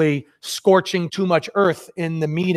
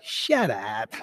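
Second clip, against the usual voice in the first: mock scolding; 3 semitones higher than usual. Hard-ass closer to over-eager partner after the meeting, mock scolding.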